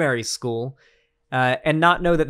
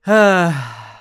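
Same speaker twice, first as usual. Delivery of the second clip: heavy exasperated sigh; 7 dB louder than usual. Real, not acted. Long tired sigh alone.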